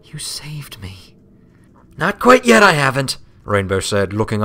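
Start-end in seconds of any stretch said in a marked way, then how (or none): 0.1-1.2 breathed out softly and slowly; grateful whisper. A narrator's reading. Twilight hugging her rescuer, a soft slow whisper. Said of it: "hugging her tightly". 2.0-3.2 high, loud tomboy pegasus voice; brash Rainbow Dash. A narrator's reading. Rainbow correcting her friend, loud and high.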